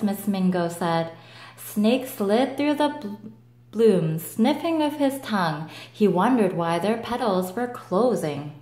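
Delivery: usual speaking voice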